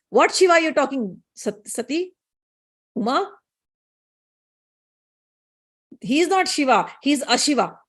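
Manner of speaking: retold angry rebuke with emphatic denials. Daksha rebuking his daughter Sati over Shiva, angry. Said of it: "Daksha got angry".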